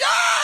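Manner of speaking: high, loud wild yell; rock star roar. Retold or imitated. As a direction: roar like smashing a guitar onstage. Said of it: "he's like".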